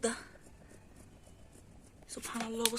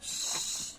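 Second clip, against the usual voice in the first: shushing; 3 dB quieter than usual. Real, not acted. Hushing the cat.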